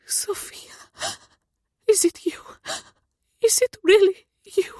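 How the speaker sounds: breathless whispered voice breaking into sobs; a mother gasping through tears; tearful disbelief. Acted. Mother hearing her lost daughter, weeping in disbelief. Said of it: "Her dear voice breaks and she weeps".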